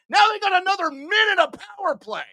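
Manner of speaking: exasperated shout. Real, not acted. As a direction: rattle it off in a high, furious rush. Streamer exasperated at another power play.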